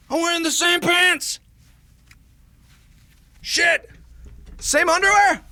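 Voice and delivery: high, squeaky disbelief climbing to loud falsetto; escalating shock. Real, not acted. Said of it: "I shouldn't be shocked".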